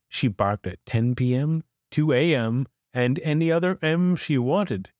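ordinary delivery, nothing marked

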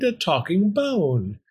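high, slow wonder; exclaimed. A narrator's reading. Fox discovering the bone can talk, exclaiming. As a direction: draw it out in amazement. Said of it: "he exclaimed".

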